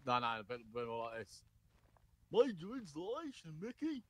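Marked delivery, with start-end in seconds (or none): 2.3-4.0 high, swooping cartoon dog voice; high-pitched goofball cartoon voice; Goofy. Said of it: "just say it in Goofy's voice".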